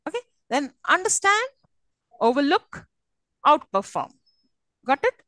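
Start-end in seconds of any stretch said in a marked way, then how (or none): 0.9-4.1 "understand", "overlook", "outperform" stressed on the ends; spaced-out verbs with last-part stress. Shown as a demonstration. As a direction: lean on STAND, LOOK, FORM. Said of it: "always the last part of it".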